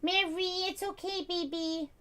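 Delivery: high soft cooing. Real, not acted. Owner soothing her sick cat, soft high coo. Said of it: "That's the only way I can talk to my cats".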